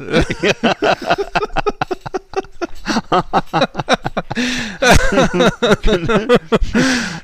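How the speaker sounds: loud high helpless laughter; long laughing fit. Real, not acted. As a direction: crack up and keep laughing.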